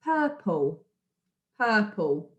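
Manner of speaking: "Purple" in two clear beats, pur-ple; syllable by syllable. Shown as a demonstration. Teacher counting beats for pupils, "purple" carefully broken in two. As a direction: sound out both chunks of "purple". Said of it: "how many beats in the word".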